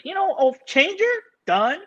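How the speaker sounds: high, breezy self-congratulation; cocky new dad. Retold or imitated. New dad bragging to his friends about day one, high and cocky. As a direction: boast like you've got fatherhood figured out.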